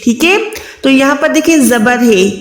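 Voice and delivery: ordinary talk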